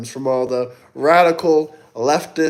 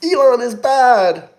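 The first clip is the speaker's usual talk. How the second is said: mock whiny voice; 9 semitones higher, 5 dB louder than usual. Mocking chat's view.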